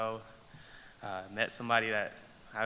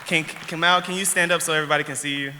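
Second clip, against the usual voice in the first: calling out; 6 semitones higher, 9 dB louder, faster than usual. Real, not acted. Calling friend to stand up.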